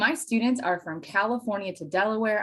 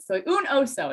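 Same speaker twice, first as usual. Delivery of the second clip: higher, eager repeat of the Spanish line; proud repeat; 7 semitones higher than usual. Retold or imitated. Child repeating to puzzled mom: higher, eager. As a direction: say it again, eager to be understood.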